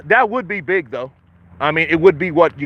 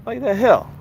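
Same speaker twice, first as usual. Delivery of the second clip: ordinary talk, nothing marked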